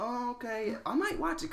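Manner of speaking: high-pitched musing "oh, okay"; acted-out dawning interest, high voice; intrigued reaction. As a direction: lift it way up, newly interested. Acts out own intrigued reaction.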